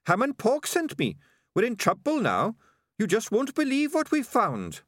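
high-pitched excitable rat reporting trouble; Sardines the rat. A narrator's reading. Rat reporting trouble to his boss, high-pitched and urgent.

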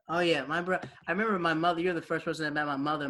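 usual speaking voice

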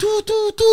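high-pitched "du du du" noises; imitated brain noise. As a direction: mimic a brain beeping, high and playful. Imitating what the brain does.

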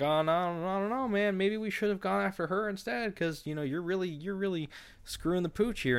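mock worried crowd; doubtful coworkers. Others doubt Jack.

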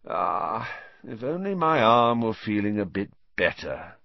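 smug, theatrical sighing. A narrator's reading. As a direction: sigh it out with showy regret. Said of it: "he sighed".

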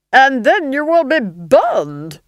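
loud, high, slow threat; domineering villainess; menacing Duchess. A narrator's reading. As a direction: relish the threat, drawn out and strident.

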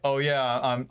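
usual speaking voice

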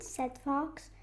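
plain reading voice

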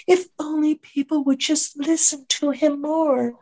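high, slow, pious lady voice; mock earnest lady. The post office woman praising Fauci, high and slow.

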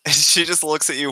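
ordinary delivery

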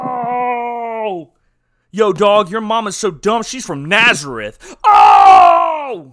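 loud high street taunt; trash talk shout. Acted. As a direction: belt the insult like a heckler. Mock trash talk as a character.

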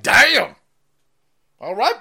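loud, high surprised "damn"; impressed exclamation. Retold or imitated. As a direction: blurt it out, impressed. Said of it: "I was like".

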